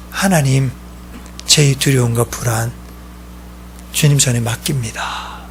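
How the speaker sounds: soft, unhurried prayer with pauses; hushed, yielding prayer; surrendering prayer. Acted. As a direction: quietly hand it all over to God. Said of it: "손을 피는 거예요".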